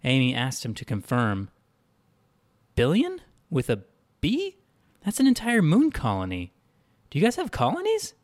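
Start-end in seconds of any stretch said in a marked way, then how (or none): 2.8-8.1 high-pitched, astonished questioning; incredulous surprise. A narrator's reading. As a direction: jump up high in disbelief. Asked to confirm huge number.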